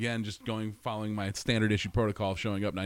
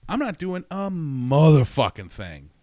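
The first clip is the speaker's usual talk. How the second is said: slow, louder and higher; slow louder defiant jab; defiant rant; 7 semitones higher, 10 dB louder, slower than usual. Retold or imitated. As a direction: drag it out in defiance. Defiant imagined speech to boss.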